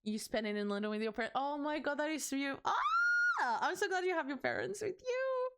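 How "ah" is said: very high, loud squeal of delight; delighted high-pitched shriek; excited squeal. Real, not acted. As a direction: shriek with glee. Excited squeal at parents news.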